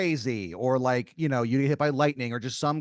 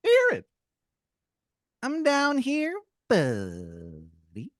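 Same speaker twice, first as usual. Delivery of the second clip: a tiny tooth creature greeting from below; squeaky tooth voice, friendly; 3 dB louder, slower than usual. Acted.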